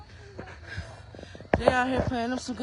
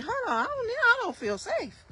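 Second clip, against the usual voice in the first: mock scared; 11 semitones higher than usual. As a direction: pretend the woods are out to get you. Joking about falling trees.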